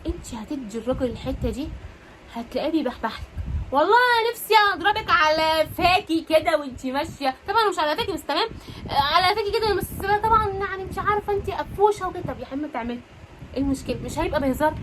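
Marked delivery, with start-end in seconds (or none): 3.7-12.2 playful flirty teasing. Acted. Wife teasing her husband about a playful slap, flirty and higher. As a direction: tease him like a cheeky wife.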